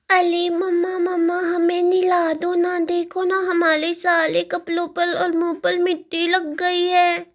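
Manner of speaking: put-on high, lisping little-girl voice; little child. Muddy daughter asks mamma for a bath, high childish lisp. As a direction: plead like a small child with baby talk.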